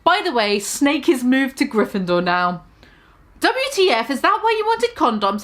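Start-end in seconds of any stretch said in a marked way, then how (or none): 3.4-5.4 high, teasing question; mock sadistic question. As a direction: needle them, pitched high. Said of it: "I asked sadistically".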